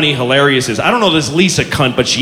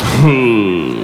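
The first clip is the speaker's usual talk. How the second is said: slurred who; 9 semitones lower than usual. Retold or imitated. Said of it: "It just slid out".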